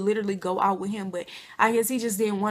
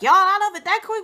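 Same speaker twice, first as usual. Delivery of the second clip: high, loud mock of the drunk man; his drunk voice; 10 semitones higher, 8 dB louder than usual. Drunk older man asking if the cash is gone, voiced high and loud. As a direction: play the tipsy man grinning at the empty purses. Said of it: "he'd be like".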